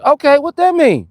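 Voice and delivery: high, loud comeback; mock indignant. Real, not acted. Answering a viewer who said he's a kid, mock indignant.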